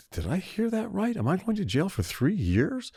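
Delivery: high, hushed, stunned inner voice; a touch of panicked disbelief in the thought. Retold or imitated. Guest recalls hearing his sentence in court, in stunned disbelief.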